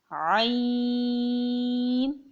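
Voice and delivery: عین held very long on one steady note; five-alif stretch. Shown as a demonstration. Teacher demonstrating the length, long held عین. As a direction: draw out عین from the throat. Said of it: "پانچ الف کی مقدار کھینچ کے".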